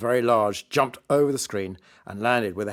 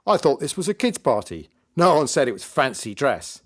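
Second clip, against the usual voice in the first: louder, higher heckling from the stage; mocking magician; 4 semitones higher, 5 dB louder than usual. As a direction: jeer for the crowd's benefit.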